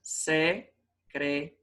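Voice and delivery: "Secretaria" slowly in five pieces: se - cre - ta - RI - a; syllable by syllable. Shown as a demonstration. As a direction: separate every syllable of "Secretaria", stressed RI apart from A. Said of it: "A sílaba tônica tá no ri".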